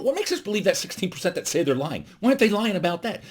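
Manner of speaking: normal talking voice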